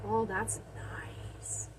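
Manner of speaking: slow pleased whisper. Real, not acted. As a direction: breathe it out, satisfied. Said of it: "Sorry, I'm whispering".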